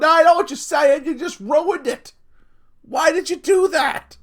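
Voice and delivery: hammed-up indignation at a friend; mock outrage.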